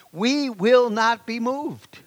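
slow, deliberate, each word weighted; declared slowly. Real, not acted. Preacher rallying the church, slow and firm. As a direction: plant your feet on every word. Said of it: "rallying refrain".

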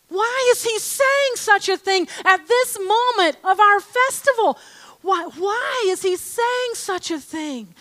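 high, excited disbelief; astonished crowd. Retold or imitated. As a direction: gasp and ask in wonder. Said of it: "they were like, gasps".

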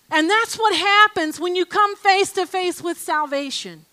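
ordinary talk, nothing marked

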